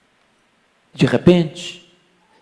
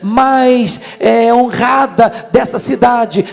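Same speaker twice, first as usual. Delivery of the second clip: raised, insistent pulpit voice; impassioned preaching; 7 semitones higher, 4 dB louder than usual. Real, not acted. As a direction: drive the warning home in high, mounting phrases. Preaches at full force. (pregação inflamada, em voz alta)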